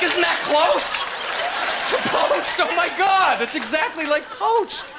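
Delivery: high, incredulous outburst; mock amazed outrage. Comic reacting to translation, high incredulous outburst. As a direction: cry out in exaggerated disbelief.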